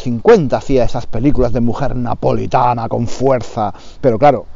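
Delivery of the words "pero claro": ordinary talk, nothing marked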